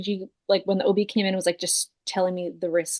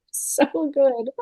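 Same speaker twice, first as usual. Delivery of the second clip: tearful laugh; 5 semitones higher than usual. Retold or imitated. Crying, laughing thanks.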